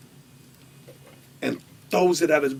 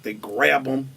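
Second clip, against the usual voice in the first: ordinary delivery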